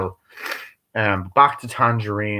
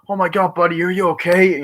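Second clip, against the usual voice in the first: mock alarm; 7 semitones higher, 3 dB louder than usual. Acted bystander panic in retelling.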